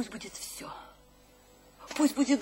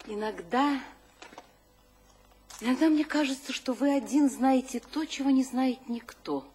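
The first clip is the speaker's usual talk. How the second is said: usual speaking voice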